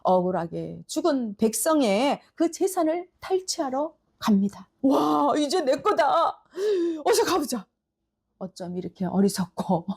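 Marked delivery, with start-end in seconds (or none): 4.8-7.6 breath of awe, then higher, drawn-out glee; acted childish excitement; childlike delight. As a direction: gloat like a child who got the toy. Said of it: "너무너무 좋아하는 어린아이처럼".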